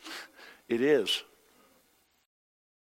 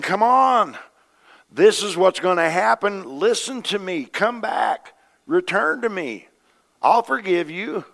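high, loud pleading call; God calling his people home, loud and high; 8 semitones higher, 5 dB louder, slower than usual. Acted. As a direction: plead with them to come back. Preacher voicing God pleading with nation.

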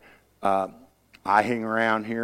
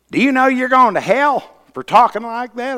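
scolding preacher; 11 semitones higher, 7 dB louder than usual. Retold or imitated. Angry preacher scolding for cussing.

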